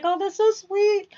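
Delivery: high, drawn-out gushing; tearfully touched. Retold or imitated. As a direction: stretch it out high, imitating being moved to tears. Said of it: "I was wiping away tears".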